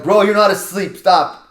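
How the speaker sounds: retold exasperated shout. Homeowner to plumber pretending he passed out, exasperated shout. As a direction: call his bluff at full volume. Retold scolding of man faking unconsciousness.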